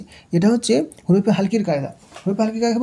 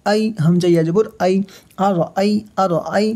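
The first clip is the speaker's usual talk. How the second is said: ordinary talk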